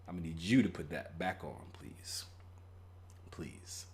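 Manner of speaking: low, soft, near-whispered please; soft pleading. Pretend. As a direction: beg quietly, nearly whispering. Asking date to dress.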